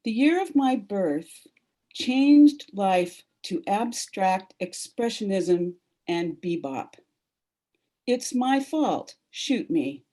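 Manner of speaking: measured poetry reading voice; solemn reading. Acted. Reads poem aloud.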